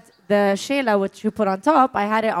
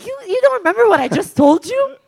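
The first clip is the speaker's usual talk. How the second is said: pitched way up in disbelief; high incredulous; 11 semitones higher, 5 dB louder than usual. Retold or imitated. Stunned guest asking grieving friend if she heard, high-pitched disbelief. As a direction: ask it high and astonished, barely believing it. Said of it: "I'm like".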